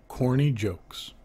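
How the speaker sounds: ordinary talk, nothing marked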